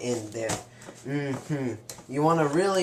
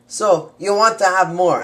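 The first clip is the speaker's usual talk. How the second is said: loud, higher hammed-up instruction; 5 semitones higher, 10 dB louder than usual. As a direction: belt it out like a TV chef. Cooking show with mom watching.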